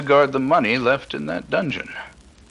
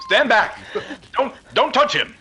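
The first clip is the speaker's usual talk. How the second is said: urgent command; 12 semitones higher than usual. Acted. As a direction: bark them back from the body. Warning others away from the body.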